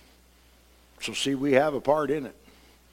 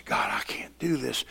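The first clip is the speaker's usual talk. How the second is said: exhausted whisper; 4 dB quieter than usual. Retold or imitated. Exhausted believer prays.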